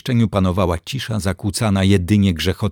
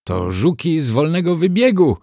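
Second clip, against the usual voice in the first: voice breaking; 8 semitones higher than usual. A narrator's reading. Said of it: "krzyknęła łamiącym się głosem".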